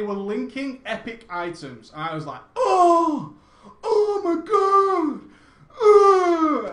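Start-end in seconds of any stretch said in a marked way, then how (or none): 2.5-6.7 much higher and louder, drawn-out oh my God; gasping at guild epics in Molten Core; ecstatic squeal. Retold or imitated. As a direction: squeal like you just saw treasure. Guild linking epic items.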